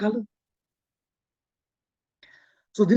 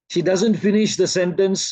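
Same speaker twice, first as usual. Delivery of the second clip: ordinary delivery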